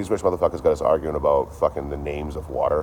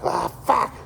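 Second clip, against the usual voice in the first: exasperated groan. Acted. Exasperated outburst.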